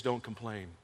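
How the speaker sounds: almost breathe the command; hushed quote. Retold or imitated. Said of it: "And he says".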